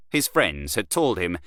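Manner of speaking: plain reading voice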